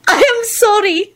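very high, giggly apology; amused, high-pitched giggling delivery. A narrator's reading. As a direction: apologise through suppressed giggles. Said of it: "she giggled".

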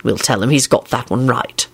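low, mildly stern voice. A narrator's reading. Man reassuring a crying woman, stern. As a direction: lay it down firmly in a low voice. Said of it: "he said sternly".

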